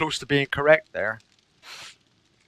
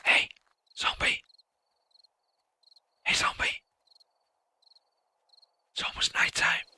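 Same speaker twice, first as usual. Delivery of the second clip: unvoiced whisper to the zombie; 6 dB quieter than usual. Real, not acted. Whispering to a game zombie at nightfall. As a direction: whisper so the zombie stays calm.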